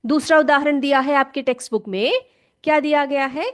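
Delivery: ordinary delivery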